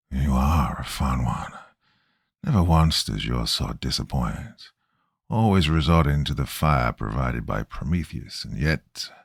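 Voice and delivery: raspy foreign voice. Acted. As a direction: hiss it out with a strange accent. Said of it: "The accent is hard to place".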